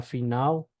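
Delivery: final said as "fi-NAU"; Portuguese final L. Shown as a demonstration. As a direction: turn the l of final into a u glide.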